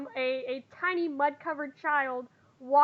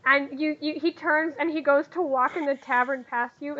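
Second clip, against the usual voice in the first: ordinary talk, nothing marked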